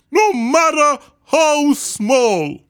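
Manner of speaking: high, loud, slow character call stressing no; Horton calling out. A narrator's reading. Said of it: "managed to call to the mare".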